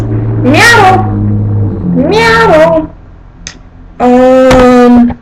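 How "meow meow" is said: a higher Mexican-accented "miau"; Mexican cat meow. Shown as a demonstration. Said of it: "Mexican cats".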